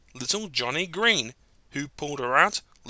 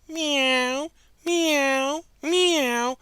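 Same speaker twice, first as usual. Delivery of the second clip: tiny high meows; high kitten mews; 10 semitones higher, much slower than usual. Acted. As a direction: mew like sad kittens. Kittens mew.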